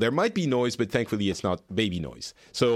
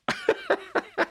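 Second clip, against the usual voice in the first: sudden squeaky laugh; high burst of laughter. Real, not acted. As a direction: crack up at the mix-up. Host laughs at the mishap.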